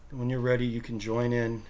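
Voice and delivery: soft, calm meditation voice with long pauses; guided meditation. Acted. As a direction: guide them gently into stillness.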